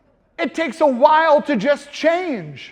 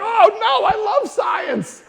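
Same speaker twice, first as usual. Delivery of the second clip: excited; 10 semitones higher, slower than usual. Retold or imitated. Sudden excited realization.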